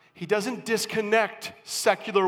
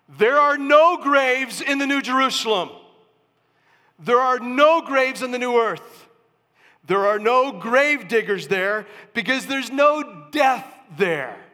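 louder, higher, stress on no; triumphant preaching; 4 semitones higher, 5 dB louder than usual. Real, not acted. Preacher proclaiming to the congregation, loud and triumphant. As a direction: proclaim it like good news.

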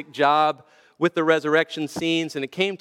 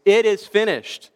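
raised, higher retold cry from the cross; loud cry; 5 semitones higher, 4 dB louder than usual. Passion-play Jesus on the cross, a loud cry. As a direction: cry it out like an actor's big final line. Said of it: "cried out in a loud voice".

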